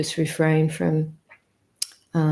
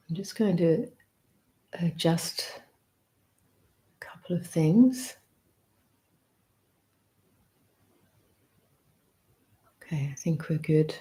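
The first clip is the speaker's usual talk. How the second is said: murmured under the breath while fixing things; just breathe the words, nearly no voice; a real whisper to herself while adjusting the stream; whispered aside; 5 dB quieter than usual. Real, not acted.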